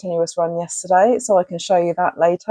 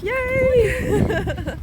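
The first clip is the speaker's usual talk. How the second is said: high, delighted yay; excited cheer; 12 semitones higher than usual. Real, not acted. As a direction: cheer with sudden joy. Cheering running buddy's return.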